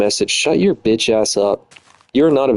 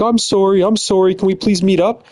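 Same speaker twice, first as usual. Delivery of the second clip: mock apology; 7 semitones higher than usual. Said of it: "she's all like".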